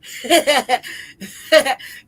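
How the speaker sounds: loud rolling laugh. Real, not acted. Still cracking up over the joke, loud laugh. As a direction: keep laughing hard.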